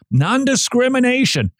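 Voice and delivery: word jumps up high then falls; Non-discrimination said high, falling fast; quick high read. Shown as a demonstration. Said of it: "Six syllables".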